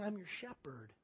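very soft, tender answer; gentle reassurance. Acted. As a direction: answer in a comforting hush. Voicing God's gentle answer in prayer.

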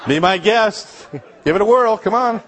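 put-on daring an old Russian brother to try a kiss, laughing and taunting; mock taunting dare. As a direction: egg him on with a grin, then call it out.

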